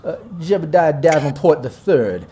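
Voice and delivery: grand, slow Southern self-introduction; pompous Dixie gentleman claiming his turf; Dixie drawl. Acted. Local boss introducing himself in his district, Dixie drawl. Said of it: "my southern drawl got a little bit of that Dixie charm".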